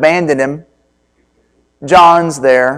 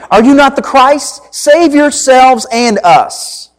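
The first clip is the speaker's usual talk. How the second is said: higher, slow bitter demand; railing; 8 semitones higher than usual. A narrator's reading. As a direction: throw it at him like a desperate criminal. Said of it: "railed at him".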